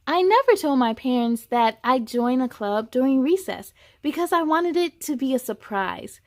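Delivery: put-on expressive children's story reading; story narration. A narrator's reading. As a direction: read it to a room of kids. Reads a children's book aloud.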